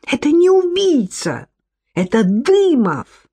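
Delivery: high, urgent correction; high-pitched acted protest; exclaiming. A narrator's reading. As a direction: swoop high, then stretch the name. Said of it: "воскликнула опомнившаяся Софья".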